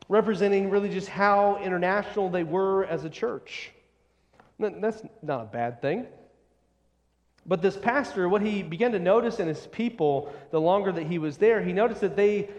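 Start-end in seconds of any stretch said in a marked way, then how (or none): none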